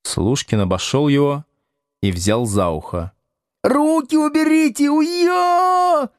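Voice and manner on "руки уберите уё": piercingly high yell of pain; Градусов. A narrator's reading. Pupil dragged by the ear at teacher, yelling. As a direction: yelp as if yanked by the ear. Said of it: "заорал Градусов".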